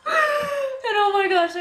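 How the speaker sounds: high panicked cry, slowed; a sudden panicked wail; freaking out. Retold or imitated. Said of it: "I was freaking out".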